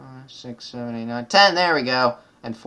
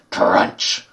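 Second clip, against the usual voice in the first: louder whispered crunch noise; stronger crunch; 4 semitones lower, 8 dB louder than usual. Shown as a demonstration. Gamer redoing the lollipop bite, louder and whispered. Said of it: "Let me try that again".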